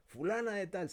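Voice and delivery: high, loud roll call; calling a name. Retold or imitated. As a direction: call the name across the room. Profesor llama nombres. (llamando el nombre en voz alta)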